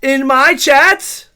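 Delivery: slow, loud, drawn-out disbelief; mock scandalized. Streamer scolds chatter over Mittens, scandalized. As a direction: hammer it out, rising in indignation.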